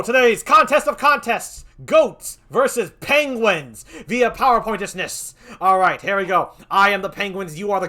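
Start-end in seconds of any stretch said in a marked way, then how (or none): 0.0-5.3 loud, slow ring announcer. Acted. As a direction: boom out the matchup like a title fight. Announcing the match.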